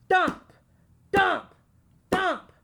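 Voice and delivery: loud, high separate thumps; banging noise. A narrator's reading. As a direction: punch each thump like a blow. Banging on door in story.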